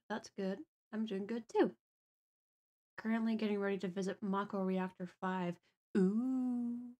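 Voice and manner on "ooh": usual speaking voice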